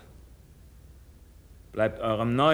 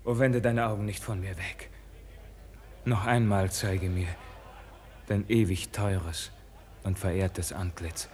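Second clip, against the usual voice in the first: soft pleading; 8 semitones lower, 7 dB quieter than usual. Acted. Begging not to be cast off.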